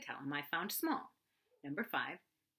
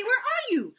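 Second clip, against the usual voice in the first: higher and louder call; 13 dB louder than usual. Acted. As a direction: shout to find a hidden friend. Pooh calling down to Piglet.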